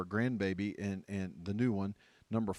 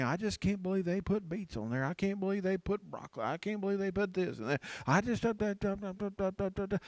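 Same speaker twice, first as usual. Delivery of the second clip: high-pitched, whiny rant running into da-da-da; high, worked-up grumbling; mock griping; 10 semitones higher than usual. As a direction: imitate a fussy complainer going on and on. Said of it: "griping and saying".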